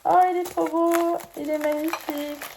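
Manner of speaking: high loud gush of delight. Real, not acted. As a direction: gush over the gift. Delight at unboxed ashtray.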